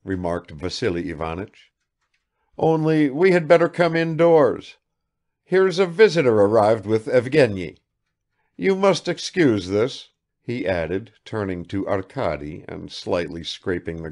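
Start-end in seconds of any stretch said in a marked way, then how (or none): 2.6-10.1 put-on higher, louder old man; fussing host; father apologizing to the guest; apologetic bluster.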